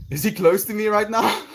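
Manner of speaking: high frightened whisper-shout; high panicked voice. Acted. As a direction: ask it in a scared high voice. High acted meme quote.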